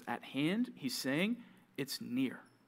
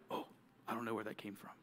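low, much softer afterthought; sheepish aside; 6 semitones lower, 10 dB quieter, faster than usual. Acted. Someone who just yelled at somebody, quietly sheepish. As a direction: drop low and quiet, taken aback at yourself.

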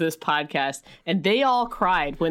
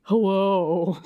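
lightly lowered voice for a man; deepened man voice. Retold or imitated. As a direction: put on a gruff man's voice. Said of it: "the woman deepening her voice to be like".

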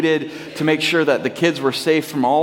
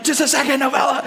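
higher-pitched exasperated retort; flustered brush-off; 9 semitones higher, faster than usual. Retold or imitated. Dad on the phone to mom, acted exasperated brush-off. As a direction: fend off an interrupting child. Dad snaps at interrupting daughter.

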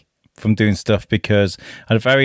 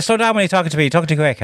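Irish local; 6 semitones higher, much faster than usual. Retold or imitated. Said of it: "He's like".

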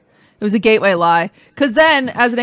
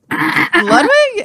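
stunned disbelief; 10 semitones higher than usual. Retold or imitated. Retelling learning her boyfriend did coke, shocked high. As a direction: say his name like you can't believe it.

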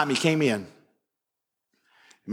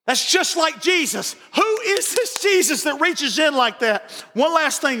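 raised, ringing pulpit voice; full-force preaching; 10 semitones higher, slower than usual. Acted. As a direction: preach it out to the congregation. Preacher returns to sermon point.

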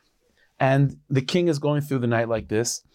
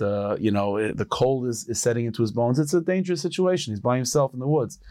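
normal talking voice

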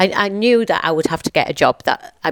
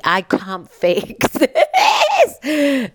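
laughing through tears; 8 semitones higher, much slower than usual. Real, not acted. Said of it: "that's the little bit of, uh, emotion in me".

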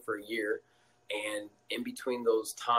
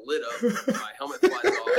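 high and loud, laughing through it; real laughter at the memory; 11 semitones higher, 7 dB louder than usual. Real, not acted. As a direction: crack up as you tell it. Laughing retelling the hit.

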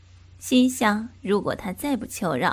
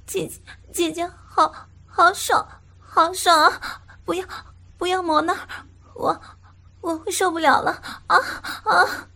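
high, breathy-free pleading woman's voice, crying out; high moaning woman; 8 semitones higher, 3 dB louder than usual. Acted. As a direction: play the woman, overwhelmed and crying out. Female character moaning, high voice.

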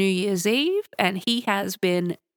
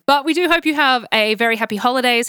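usual speaking voice

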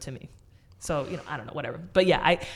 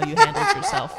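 laughing; 6 dB louder than usual. Real, not acted. Laughs mid-quote.

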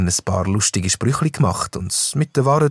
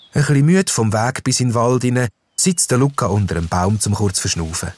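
usual speaking voice

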